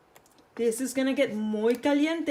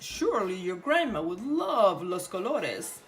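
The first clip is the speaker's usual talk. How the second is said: wolf voice; 2 semitones lower than usual. A narrator's reading. Wolf luring Roja to the flowers, lightly sly voice. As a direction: coax her like a sly wolf.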